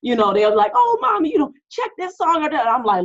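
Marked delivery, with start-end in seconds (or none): 0.7-2.7 high-pitched mock kid pestering Mom; imitating her kids. Older kids pitching a song to their mom, high imitated kid voice. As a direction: mimic an eager child, voice pitched way up. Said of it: "like, my, my older kids".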